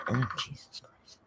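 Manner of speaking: exasperated whisper under the breath; whispered Jesus Christ aside. Real, not acted. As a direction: mutter it to yourself, annoyed.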